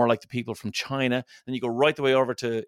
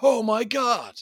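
mock amazement; 10 semitones higher than usual. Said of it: "And I was like".

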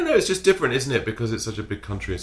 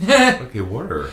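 a hammed-up American "Okay, water" with a hard r, higher and louder on okay; mock American accent; 5 semitones higher, 8 dB louder, slower than usual. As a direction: play a dim American saying water. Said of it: "I like when you guys do our accent".

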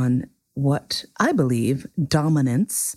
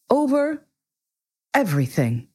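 magnificent simplicity; 7 semitones higher than usual. A narrator's reading. King telling the prince what he rules, with magnificent simplicity. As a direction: state it simply, as absolute ruler. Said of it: "with magnificent simplicity".

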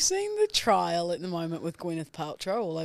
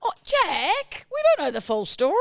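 mock prim caution; 10 semitones higher, 4 dB louder than usual.

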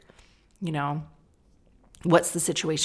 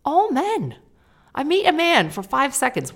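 mock exasperation; 9 semitones higher, 5 dB louder than usual.

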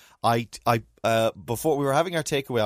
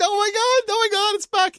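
much higher, delighted squeal; excited squeal; 7 dB louder than usual. Retold or imitated. Imitating wife's delight as 30 Rock comes back, high squeal. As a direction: squeal with joy like the wife did. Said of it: "And it was adorable".

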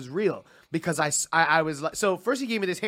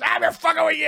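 high mock shout; imitating a shouted gotcha; gleeful prank reveal; 11 semitones higher, 4 dB louder than usual. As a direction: yell the reveal, pitch jumping up.

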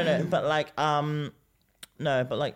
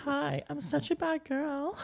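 put-on high girly voice; slower than usual. Elphaba voice beside roadman.

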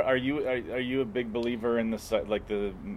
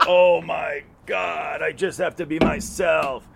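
drawn-out, higher, louder mock awe; mock revelation; 5 semitones higher, 5 dB louder, much slower than usual. Mocking an ayahuasca tripper's epiphany, in slow awe. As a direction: gush slowly like a stoned epiphany. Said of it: "And then you go".

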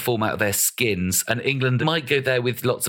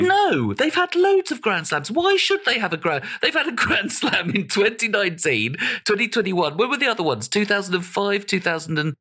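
indignant protest; 7 semitones higher than usual. Real, not acted. Protests against a Welsh Grand Slam.

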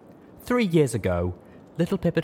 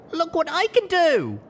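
put-on much higher, louder, slow, thrilled child; beaming excited filly; 7 dB louder than usual. A narrator's reading. Little alicorn filly showing off her magic to Fluttershy. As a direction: show off with pure delight. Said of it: "beamed happily".

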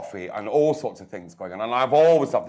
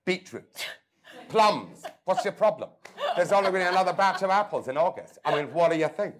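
laughing retort; 9 semitones higher than usual. Retold or imitated. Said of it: "I went".

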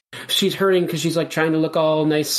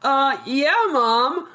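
daughter; 8 semitones higher, much slower than usual. Acted. Daughter answering mom in scene.

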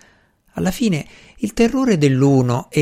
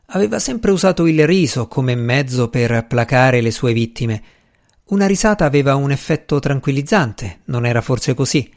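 ordinary delivery

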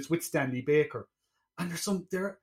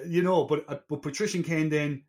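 ordinary delivery

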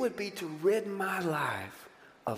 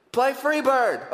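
loud, higher, drawn-out mock heckle; loud slow heckler's shout; drunk concert yell; 8 semitones higher, 7 dB louder, slower than usual. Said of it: "some drunk guy who yells what".

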